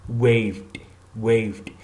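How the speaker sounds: "waved" as one syllable ending in voiced d; "waved" with no extra -ed syllable; final D sound. Shown as a demonstration. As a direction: say "waved" with just a d after the v. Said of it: "Waved is pronounced waved".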